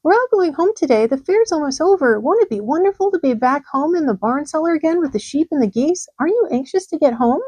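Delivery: high, eager pig voice looking forward; high excited piglet; Wilbur, excited. A narrator's reading. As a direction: bubble over about going home. Wilbur excited about going home.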